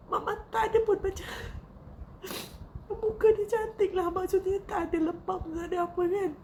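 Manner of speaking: real tears, words strained as she recounts the death; tearful voice. Real, not acted. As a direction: speak through grief, holding back crying.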